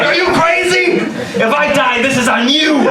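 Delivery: high-pitched indignant outburst; mock fury, pitch shooting way up; furious. Man blaming friend over Skype, comically furious. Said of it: "which made me furious".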